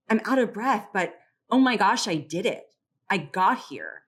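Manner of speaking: breathless pride, lightly acted; excited. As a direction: let the triumph show as you quote her. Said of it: "The first woman was excited and joyous and hopeful".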